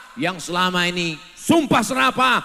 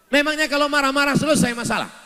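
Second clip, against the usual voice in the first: rhetorical challenge; 9 semitones higher, 3 dB louder, much faster than usual. Acted. Preacher challenging congregation.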